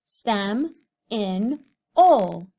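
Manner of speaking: each syllable said apart, slow and clear; spelling-lesson sounding out; syllables split. Shown as a demonstration. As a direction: chop Seminole into Sem, in, ol. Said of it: "say that in syllables with me".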